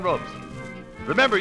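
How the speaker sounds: usual speaking voice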